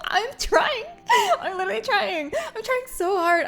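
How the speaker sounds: high, loud laughing wail; exasperated, half-laughing whine; whiny protest. Real, not acted. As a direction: plead comically that you're doing your best. Protests advice to get stronger.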